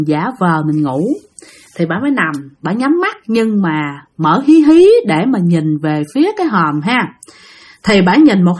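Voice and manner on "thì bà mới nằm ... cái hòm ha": usual speaking voice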